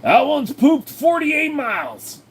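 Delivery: high, slow shouted cartoon voice; slow high comic yell. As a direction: bellow it like a goofy cartoon braggart. Boasting in a cartoon voice.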